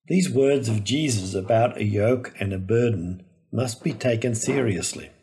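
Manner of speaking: ordinary talk, nothing marked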